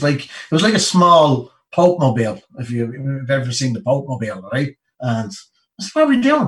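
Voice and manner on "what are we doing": high, loud startled ask; blurting out in confusion; baffled question. Retold or imitated. Soldier to his mate on the jeep, baffled and high. Asking the driver on parade day.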